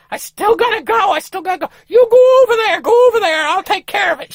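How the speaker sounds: very high, loud, crying wife voice; frantic wife shooing him off; desperate wife. Retold or imitated. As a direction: wail that you still have to go. Wife desperate, sending him away.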